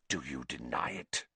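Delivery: low, very soft challenge; shaking accuser. A narrator's reading. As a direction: demand an answer under your voice. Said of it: "who was shaking too".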